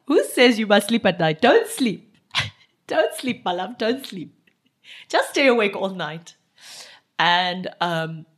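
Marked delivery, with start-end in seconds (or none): 0.1-3.4 hammed-up loud cry, higher; loud mock rally. Woken boyfriend urging her, loud mock rally. 3.4-6.3 half-asleep partner mumbling, then getting louder; a drowsy boyfriend rallying into loud cheer; sleepy voice. Retold or imitated. Boyfriend woken at night, sleepy then loud. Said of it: "in his sleepy voice".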